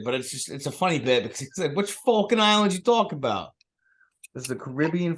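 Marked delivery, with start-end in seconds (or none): 1.7-3.5 put-on Boston classmate, pitched up at first; Bostonian accent. Repeating the classmate's bit for a friend, Bostonian accent. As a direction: question it incredulously in Boston accent. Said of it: "having a Bostonian classmate".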